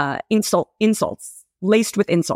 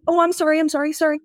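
flustered apology; 9 semitones higher, faster than usual. Retold or imitated. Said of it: "Someone bumps into me".

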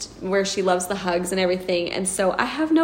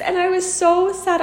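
ordinary talk

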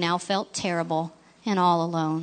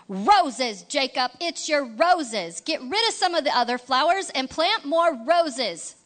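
pushy Leroy; 6 semitones higher than usual. Acted. Leroy pushing roses on Jacob.

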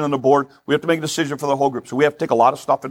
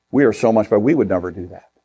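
low, smug Pharisee superiority; self-righteous pride; 6 semitones lower, faster than usual. Acted. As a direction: speak down as a proud Pharisee. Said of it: "self-righteous pride attitude".

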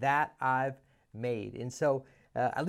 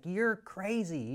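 high, slow mock disbelief; incredulous Ken; 7 semitones higher, slower than usual. Ken rejecting the urgent meeting, slow high disbelief.

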